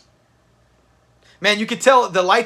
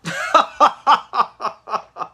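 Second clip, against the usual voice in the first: real laughter at Fred; 5 semitones higher than usual. Real, not acted. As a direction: laugh hard at the screen.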